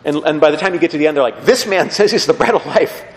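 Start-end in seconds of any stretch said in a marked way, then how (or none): 1.4-3.0 higher, scoffing with a laugh; mock disdain. Crowd at the end talking about Jesus, mock disdain. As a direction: scoff at him, half laughing. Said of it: "their esteem for him really tanks".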